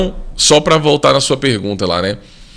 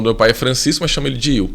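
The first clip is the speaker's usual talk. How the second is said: normal talking voice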